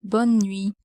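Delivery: "Bonne nuit" with the final E of bonne and T of nuit silent; "Bonne nuit" pronounced in French, ending on the vowel of "nuit"; silent final letters. Shown as a demonstration. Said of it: "are not pronounced".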